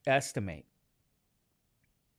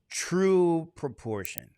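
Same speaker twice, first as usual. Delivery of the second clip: ordinary delivery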